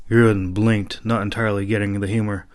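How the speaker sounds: ordinary delivery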